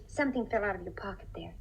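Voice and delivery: whispered, leaning in close; confiding whisper; hushed aside. Acted. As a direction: drop to a whisper to point it out. Sly hint about dropped letter.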